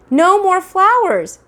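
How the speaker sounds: high, loud and slow stern mother; firm scolding. Acted. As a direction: lay down the law to the toddler.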